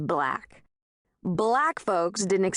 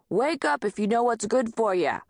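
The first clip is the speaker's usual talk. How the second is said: put-on hollering in a Peckerwood accent; 5 semitones higher than usual. A narrator's reading. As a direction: yell it through the door in a Peckerwood twang. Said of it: "the landlord hollered in a thick Peckerwood accent".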